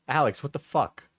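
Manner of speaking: ordinary talk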